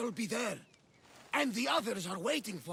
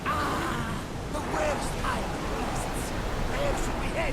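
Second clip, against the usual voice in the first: rousing; 6 dB louder than usual. Acted. Rousing talk of hunting.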